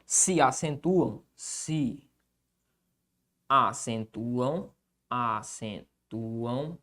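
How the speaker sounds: very slow, low, soft syllables; dictating. Real, not acted. Teacher writing the rule on the board, slow dictation. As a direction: draw each word out quietly as you write.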